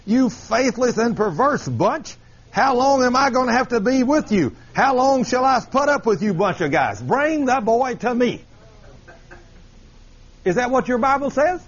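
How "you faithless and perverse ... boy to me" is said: higher, fed-up scolding; exasperated Jesus paraphrase. Acted. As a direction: scold them like a teacher out of patience. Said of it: "let me kind of paraphrase that for you".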